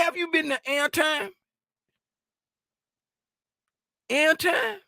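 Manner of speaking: high, loud teasing shout; shouting his name to call him out. Real, not acted. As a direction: holler at him across the room. Calling out to E-Rock.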